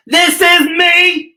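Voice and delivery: high, loud, slow declaration; a slow proud anthem shout. Shown as a demonstration. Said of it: "what you have to say?".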